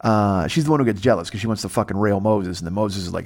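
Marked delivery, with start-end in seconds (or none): none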